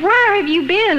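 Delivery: husband's angry demand; furious demand. Retold or imitated. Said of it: "He was just furious".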